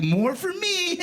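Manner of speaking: high, giddy little boast; gleeful. Retold or imitated. Young self grabbing free rum, high giddy crow. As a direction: crow it like a greedy teen. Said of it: "and I was like".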